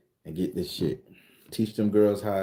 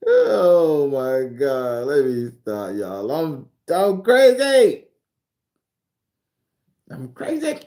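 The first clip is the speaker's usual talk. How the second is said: high, loud cackling laughter; shrill overlapping reality fight; 10 semitones higher, 7 dB louder, much slower than usual. Real, not acted. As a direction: laugh wildly over everyone. Crowd laughing loudly.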